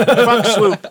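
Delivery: loud, high laughing curse. Real, not acted. Laughing jab at airline.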